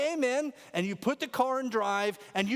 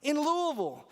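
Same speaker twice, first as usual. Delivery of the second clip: upset complaining; 8 semitones higher than usual. Retold or imitated. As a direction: whine it like a lost, furious tourist.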